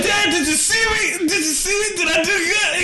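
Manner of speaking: put-on high, eager kid begging for praise; bouncing with excitement for dad's approval; excited asking. Son asking dad after match, high and eager.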